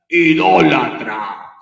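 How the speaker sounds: drawn-out loud cry of idolater; slow, loud accusing yell; mock shouted accusation. A priest imitating a woman yelling at him in the street, loud and slow. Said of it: "la señora me grita".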